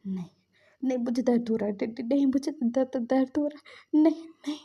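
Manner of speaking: mock panic yell, stumbling over the words; panicked screaming. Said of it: "चिल्लाने लगता है".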